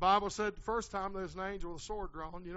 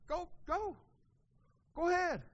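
high, brief retold go-ahead; giving in; 10 semitones higher than usual. Pastor telling a church member to leave, giving in. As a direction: let them go in a raised, mock-resigned pitch. Pastor acts out exasperated permission.

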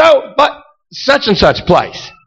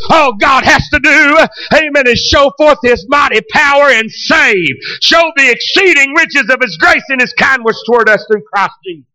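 full-force preaching; 4 semitones higher than usual. Acted. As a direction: preach it out, building to a shout. Sustained shouted preaching.

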